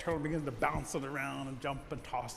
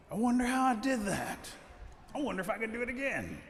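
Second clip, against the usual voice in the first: higher, amazed musing; pitched-up curious wonder; wondering turtle; 7 semitones higher than usual. Acted. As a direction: marvel at your own feat. Turtle marvels at own feat.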